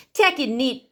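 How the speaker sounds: plain reading voice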